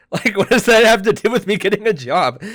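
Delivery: high, loud laughing question; laughing disbelief. Real, not acted. Laughing disbelief at the app claim.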